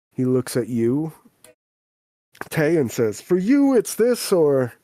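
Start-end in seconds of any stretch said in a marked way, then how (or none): 3.2-4.7 "you" leaps much higher and louder; stress on YOU. Acted. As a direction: hit "you" high and hard, then come down. Same line turned on second child.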